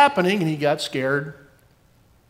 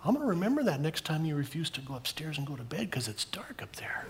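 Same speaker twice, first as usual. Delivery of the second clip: teasing whisper; 10 dB quieter than usual. Real, not acted. Teasing child about bedtime fear.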